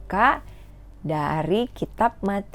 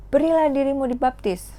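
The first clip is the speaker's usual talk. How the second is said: raised, higher prophet's cry; imitating John's call; 7 semitones higher than usual. Teacher acting John calling people to baptism, raised voice. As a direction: proclaim it to the crowd. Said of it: "Jadi Yohanes Pembaptis bilang".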